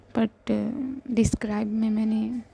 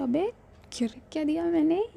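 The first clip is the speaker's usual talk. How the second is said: higher, fast, rising protest; baffled protest; 6 semitones higher, faster than usual. Acted. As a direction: protest with disbelief. (ऊँची, तेज़ आवाज़ में हैरानी भरा विरोध)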